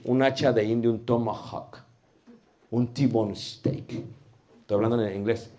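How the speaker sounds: ordinary talk, nothing marked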